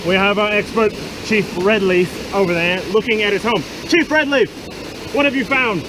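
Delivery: loud, high, excited field report; shouting reporter. Acted. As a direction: yell over the wilderness noise.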